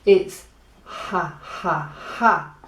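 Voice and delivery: slow "ha ha ha" with a throaty Spanish J; Spanish J laugh. Shown as a demonstration. Teacher to students, slow throaty Spanish J laugh. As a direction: breathe each "ha ha ha" from the throat, slowly. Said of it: "the letter J makes a H sound".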